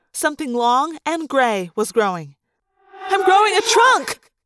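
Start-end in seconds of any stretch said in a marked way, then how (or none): 3.1-4.3 high, loud frightened cry; panicked cry. Acted. Rachel crying to friends mid-morph, panicked cry. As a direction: cry out in horror. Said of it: "I cried".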